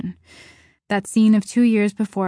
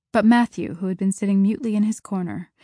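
plain reading voice

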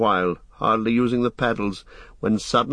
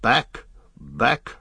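short, mildly raised cries; cry of command; 3 semitones higher than usual. A narrator's reading. Aragorn warns the boats away from rapids, calling out. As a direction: order the boats back, two separate calls. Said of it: "cried Aragorn".

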